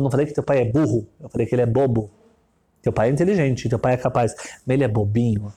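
low, condescending rich man; rich man's voice. Retold or imitated. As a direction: talk down to the child, low and sure. Rich man explains calmly.

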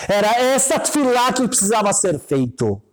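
high-pitched, impassioned affirmation; emphatic praise. Retold or imitated. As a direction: rise suddenly with fervent conviction. Rabbi's emphatic praise, retold. (afirmação exaltada, em tom agudo)

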